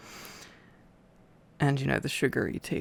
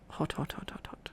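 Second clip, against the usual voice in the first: whisper; 9 dB quieter than usual. Acted. Reaction to hot tea.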